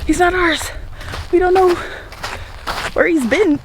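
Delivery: high, put-out get-him-out voice; mock exasperation at the stray rooster; exasperated. To her husband about the rooster, exasperated. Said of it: "and I was like".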